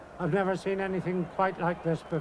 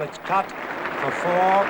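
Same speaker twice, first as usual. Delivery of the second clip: louder, drawn-out boundary call; excited call; 8 dB louder, much slower than usual. Real, not acted. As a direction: ring out the four slowly. Boundary hit in live commentary.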